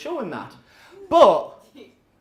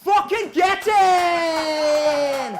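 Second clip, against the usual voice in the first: triumphant shout; 9 semitones higher, slower than usual. Real, not acted. Comic gloating at rival comedians after the flag, shouting. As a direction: roar it like a winning goal.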